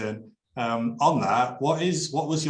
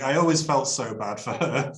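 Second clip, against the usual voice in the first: normal talking voice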